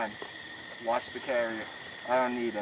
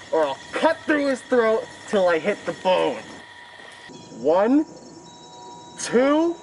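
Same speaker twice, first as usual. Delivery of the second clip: menacing threat; 6 semitones higher than usual. Acted. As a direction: bark the ultimatum, rising. Villain counts down to execution.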